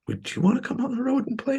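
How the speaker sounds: tentative invitation. Retold or imitated. Musician asking his guitar hero on tour, tentative. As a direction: ask it gingerly, way up in pitch.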